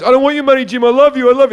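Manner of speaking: high, loud imitation of an emotional mate; hammed-up drunken-style protest of affection; mock loud pleading. Acting out the pleading friend.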